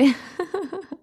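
short, high-pitched chuckle; amused laugh. Real, not acted. Podcast host joking to listeners about tasting food, amused laugh. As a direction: laugh briefly at your own remark.

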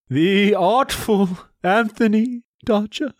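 high, slow, grand declaiming; classical stage acting. Shown as a demonstration. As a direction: stretch it out like a stage actor. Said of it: "a Shakespearean thing of awesomeness".

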